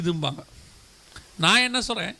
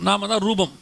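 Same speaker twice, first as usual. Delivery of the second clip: ordinary delivery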